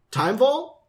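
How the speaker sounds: ordinary talk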